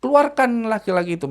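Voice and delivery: high commanding voice; possessed girl ordering. Retold or imitated. Possessed girl demands the man be removed, high command.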